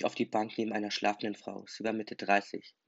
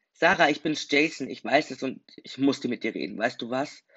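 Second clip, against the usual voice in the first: loud, higher, bursting with pride like a prize winner; triumphant calling out; 3 semitones higher, 8 dB louder than usual. A narrator's reading. Jason calls up to Sarah in her flat, loud and triumphant. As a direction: announce it like you just won a prize. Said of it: "Ich redete so, als hätte ich einen Preis bekommen".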